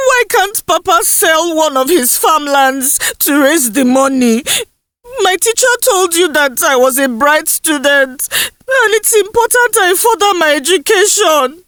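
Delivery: put-on tearful pleading girl. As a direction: beg through tears, the voice breaking. Indusa pleads near tears.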